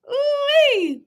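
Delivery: high wincing ooh-wee; a high pained whoop. Real, not acted. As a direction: squeal it out like it hurts to hear. Reacting to painful toe story.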